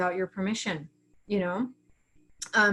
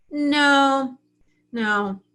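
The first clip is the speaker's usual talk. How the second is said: flat refusal; 4 semitones higher, 5 dB louder than usual. Retold or imitated. Refusing other people.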